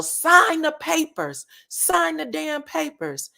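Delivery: high, slow insistent voice; a hint of a pushy wife demanding the signature; mock demanding wife. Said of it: "Melody tells Martell".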